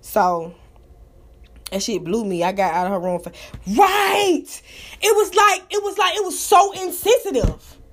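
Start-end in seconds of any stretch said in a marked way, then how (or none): 3.8-7.8 worked-up outrage. Real, not acted. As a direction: get fired up about how insensitive it was. Outraged about the insensitive show.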